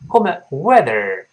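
normal talking voice

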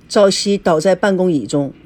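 ordinary delivery

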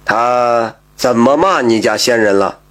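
calm, measured, low-pitched question; unhurried questioning. A narrator's reading. Wary magistrate questioning a slippery accuser, unhurried. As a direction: take your time asking, pitched low. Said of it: "他不紧不慢的就问".

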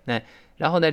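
usual speaking voice

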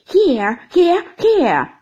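loud, hammed-up cheering of hear; mock applause. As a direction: Steerforth calling out in mock approval. Steerforth mocking applause.

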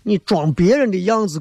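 ordinary talk, nothing marked

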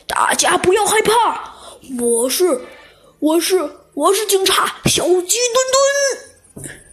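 a hint of a brave little cop stepping in; proud slow hero's call; righteous hero voice. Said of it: "一个充满正义感的声音".

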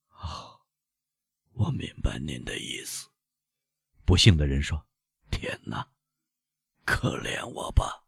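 faint voiceless whisper, slowing at the end; dying whisper. Acted. Dying man praying for mercy, faint whisper. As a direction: breathe out a last plea with no voice. Dying man's last plea.

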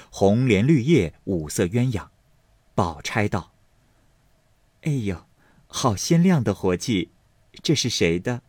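measured, unhurried audiobook storytelling; story narration. A narrator's reading. Narrator reading a classic novel to listeners, measured storytelling.

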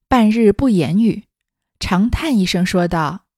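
measured, projected storybook reading; story narration. A narrator's reading. Narrator reading a classic novel to listeners, story narration. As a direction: read it out deliberately, acting the dialogue lightly.

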